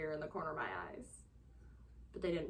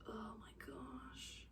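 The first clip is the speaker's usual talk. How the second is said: slow breathy whisper of disbelief; slow exasperated whisper; overwhelmed whisper; 4 semitones higher, 16 dB quieter, much slower than usual. Real, not acted. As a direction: breathe it out, drained, almost no voice. Overwhelmed by confusing book.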